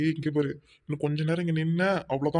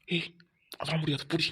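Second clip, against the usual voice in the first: whisper. Acted. Secret bickering continues.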